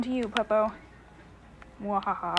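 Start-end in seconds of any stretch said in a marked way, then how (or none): 1.8-2.4 mad scientist. Acted. Gloating over blood chalice.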